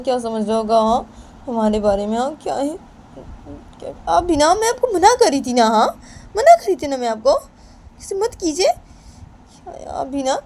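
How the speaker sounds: high, loud scolding; shrill protest, pushing him away; high furious rebuke; Payal angry. Acted. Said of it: "तो पायल पूनम को बोलती है".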